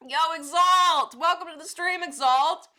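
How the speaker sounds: loud, high, slow welcome shout; excited greeting. Real, not acted. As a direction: call out a big welcome. Greeting a new viewer.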